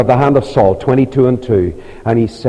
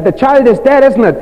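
anxious question; 12 semitones higher, 5 dB louder than usual. Retold or imitated. David asks about his child.

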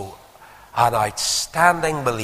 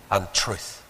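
normal talking voice

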